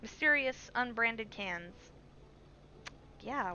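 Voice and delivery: normal talking voice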